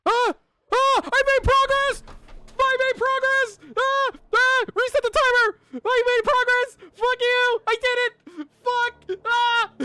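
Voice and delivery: high, full-volume victory yelling; triumphant screaming. Real, not acted. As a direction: scream it out in real, frantic triumph. Screaming on beating a game.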